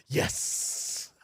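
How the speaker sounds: unvoiced, whispered "yes" with a long hiss; hissed mock celebration. Streamer imagining T Orlando getting divorce papers, whispered triumph. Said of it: "he was like".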